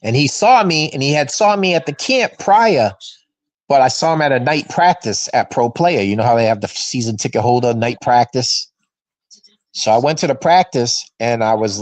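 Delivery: normal talking voice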